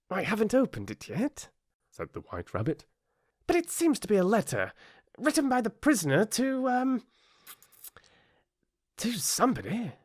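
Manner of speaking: high, small rabbit voice; timid rabbit. A narrator's reading. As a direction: squeak it out nervously.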